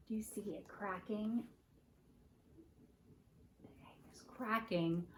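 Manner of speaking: hushed, soft whisper; secretive whisper as the egg hatches; suspenseful whisper. Real, not acted. Hushed suspense while egg cracks.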